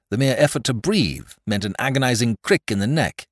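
ordinary delivery

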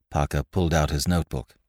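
ordinary delivery, nothing marked

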